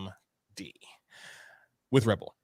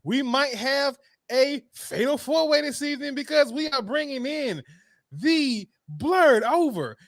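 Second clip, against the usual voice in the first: hype announcer; 10 semitones higher, 6 dB louder than usual. Acted. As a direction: announce the surprise entrant like a ring announcer. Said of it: "hyped ring announcer".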